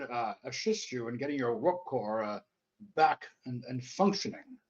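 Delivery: normal talking voice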